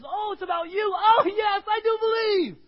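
the father's joyful breakthrough cry; joyful shout of faith. Acted. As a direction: cry out in sudden realization. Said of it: "he just says".

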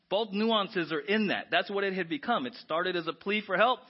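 normal talking voice

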